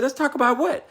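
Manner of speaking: high, puzzled retold question; a husband baffled in bed by his wife's question; confused surprise. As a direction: play it caught off guard, clueless. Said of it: "I said".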